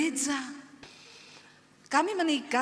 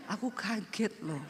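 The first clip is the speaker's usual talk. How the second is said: softer, slower mild complaint; husband quietly complains of being startled; soft Solo complaint; 2 semitones lower, 6 dB quieter than usual. Retold or imitated. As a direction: murmur it like a startled gentle man. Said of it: "orang Solo, kaget aja diomongin".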